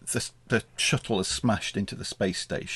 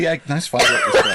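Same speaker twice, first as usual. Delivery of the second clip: laughing sarcasm; 7 semitones higher, 7 dB louder than usual. Retold or imitated. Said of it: "I was like".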